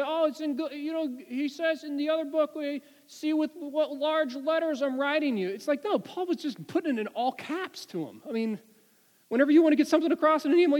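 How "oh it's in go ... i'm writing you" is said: high, mock-naive voice for people's argument; mocking the other view. Preacher mimicking people who say it's a physical ailment, high mock voice. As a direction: parody the critics in a raised pitch. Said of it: "And people say".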